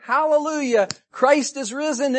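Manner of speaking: high, loud Easter cry; jubilant proclamation. Retold or imitated. Preacher voicing the congregation's praise, jubilant proclamation. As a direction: declare it at full preaching force.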